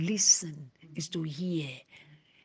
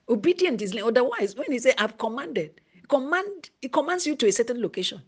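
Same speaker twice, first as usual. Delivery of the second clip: louder, higher preaching climax; rising preaching; 5 semitones higher, 5 dB louder than usual. Real, not acted. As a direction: build to a fervent shout. Preaching builds to shouting.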